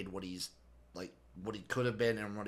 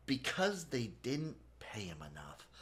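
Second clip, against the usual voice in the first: whispered aside; 6 dB quieter than usual. Acted. Sportscast aside.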